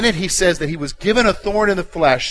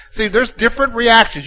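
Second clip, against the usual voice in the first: ordinary talk, nothing marked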